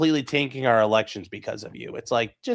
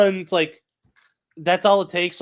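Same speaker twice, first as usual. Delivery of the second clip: ordinary delivery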